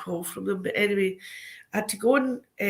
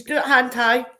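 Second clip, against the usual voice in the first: laughing telling-off; 6 semitones higher, 4 dB louder than usual. Retold or imitated.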